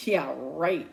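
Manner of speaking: ordinary delivery, nothing marked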